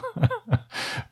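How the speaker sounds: high, helpless burst of laughter; real laughter breaking out. Real, not acted. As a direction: crack up at the joke titles. Laughing at the fake titles.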